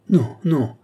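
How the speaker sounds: high, loud cry of refusal; exclaimed protest. A narrator's reading. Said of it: "s'écria Lorenza".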